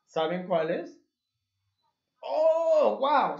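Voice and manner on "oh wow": high, loud delighted wow; mock surprise. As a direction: praise the kids with a big wow. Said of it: "Sí supieron". (asombro fingido para felicitar)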